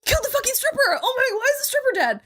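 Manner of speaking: high, fast panicked Schmidt impression; frantic high-pitched doofus panic; Schmidt freakout. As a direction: squeal it out like Schmidt losing it. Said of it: "that's when he does the most Schmidt thing".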